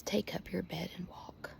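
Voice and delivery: hushed whisper, barely voiced; Jesus' gentle words. To the congregation, Jesus' words softly whispered. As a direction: breathe Jesus' command like a secret.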